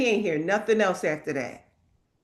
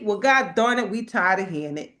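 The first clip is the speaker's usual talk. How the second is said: ordinary delivery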